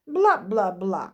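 slow, drawn-out dismissive taunt; mocking mimicry. A narrator's reading. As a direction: stretch out the nonsense syllables to mock. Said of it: "передражнила Христина".

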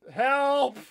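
high, loud cry for help; shouted plea. Real, not acted. Player stuck in a little block, shouting for help. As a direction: yell for help at full voice.

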